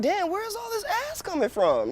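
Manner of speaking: high mock-incredulous exclamation; amazed. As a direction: pitch up in wide-eyed disbelief. Quoting his own amazement.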